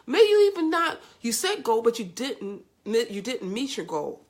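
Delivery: ordinary talk, nothing marked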